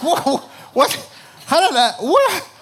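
startled whoa going high and loud; mock shock at a hole in the dash; hammed-up surprise with a laugh; surprised. Car break-in surprise.

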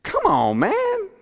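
a drawn-out, incredulous "Come on, man" cracking high on "man"; Jesus brushing off a squabbling brother, half-crying in mock exasperation; exasperated Jesus. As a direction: plead like a fed-up friend. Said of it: "Jesus replied".

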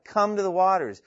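high, loud heartfelt appeal; imploring. Acted. God calling the thirsty to the waters, imploring. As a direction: implore them to come. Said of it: "It's imploring, the Heavenly Father imploring".

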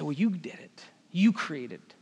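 whispered accusation; whispered blame. Retold or imitated. Us quietly blaming God, whispered. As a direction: hiss the blame under your breath.

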